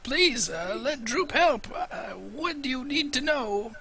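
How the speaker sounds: high, slow pleading goblin voice. Acted. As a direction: beg like a starving goblin.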